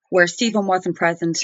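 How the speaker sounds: ordinary talk, nothing marked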